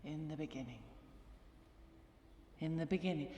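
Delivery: very quiet, low tentative opening; barely voiced, low and soft; hushed false start. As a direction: try the words out under your breath. False starts before a sermon.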